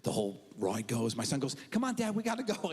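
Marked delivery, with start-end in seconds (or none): 1.7-2.7 lightly voiced kid, higher and quick; hint of a kid hurrying Dad along; urging child. Son urges dad to leave.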